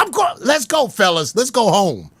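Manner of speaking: ordinary delivery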